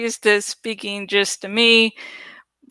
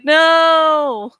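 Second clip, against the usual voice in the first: mock outrage; 7 semitones higher, 5 dB louder than usual.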